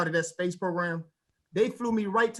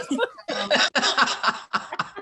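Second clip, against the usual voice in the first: high laugh; 8 semitones higher, 4 dB louder than usual. Real, not acted. Laughs after sign-off.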